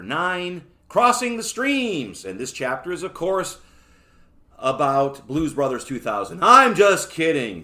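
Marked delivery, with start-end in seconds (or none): 0.9-2.2 high, loud, drawn-out title announcement; announcer-style title. Real, not acted. Host reading out chapter nine's title, announcer-style. 6.4-7.6 much louder, higher and drawn out; loud joke retraction. Real, not acted. Host admitting his Blues Brothers joke, loud retraction.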